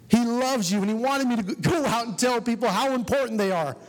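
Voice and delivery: high, building sermon cry; preacher driving the point home. Acted. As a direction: preach it with rising fervor.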